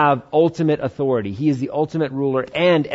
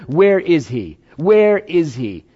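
rising, louder plea repeated; mock desperate prayer, louder and slower on the second; pleading cry; 2 semitones higher, 5 dB louder, much slower than usual. Pleading prayer voiced by preacher.

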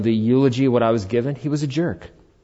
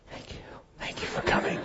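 hushed, breathy whisper of thanks; 16 dB quieter than usual. Retold or imitated. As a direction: whisper it nervously to the mourners. Greeting mourners, walking in cold.

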